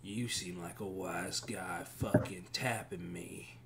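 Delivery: quiet, whispery tough guy; surly bar patron. Acted. Bar stranger mocks the one who tapped him, whispery menace. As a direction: throw the insult back under the breath.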